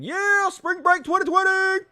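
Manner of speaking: high, drawn-out cheer; hammed-up high-pitched party holler; party whoop. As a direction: yell like a spring-break reveler.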